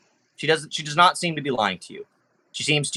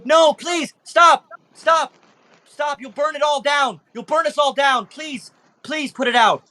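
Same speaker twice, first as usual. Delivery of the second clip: pleading creature; 14 semitones higher, slower than usual. Acted. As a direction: beg in panic to stop the fire, voice up high. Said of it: "She goes".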